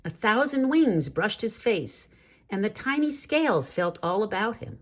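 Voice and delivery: ordinary delivery, nothing marked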